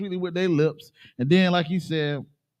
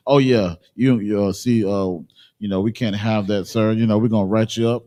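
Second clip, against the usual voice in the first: a lightly voiced smug boss, louder and lower; mock boss; 7 semitones lower, 4 dB louder than usual. Worker mimicking his boss firing him, mock smug. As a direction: play the boss firing him, with fake politeness.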